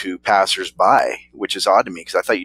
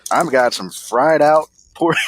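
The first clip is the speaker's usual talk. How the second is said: a slow, higher, lightly put-on hillbilly twang; 7 semitones higher than usual. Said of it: "some hillbilly that".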